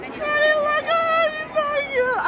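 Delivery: high, stretched-out adoring squeal; fangirl squeal. Real, not acted. Fan showing off cute Amanda, squealing. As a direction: squeal adoringly, drawing out the words.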